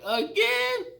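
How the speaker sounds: high, drawn-out incredulous cry; the whole room can't believe it; crowd disbelief. Retold or imitated. Crowd reacting to a movie repeat failure, high incredulous cry. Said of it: "everybody was like".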